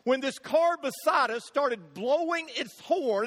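normal talking voice

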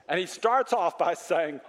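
ordinary talk, nothing marked

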